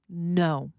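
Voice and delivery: one short "No" with a firm falling tune. Shown as a demonstration. Parent refusing a child, firm "No". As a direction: cut it off as a single final "No". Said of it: "Listen to the different ways".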